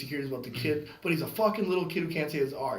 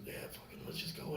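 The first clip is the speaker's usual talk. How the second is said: whispering; 14 dB quieter than usual. Retold or imitated. Buddy's hushed signal.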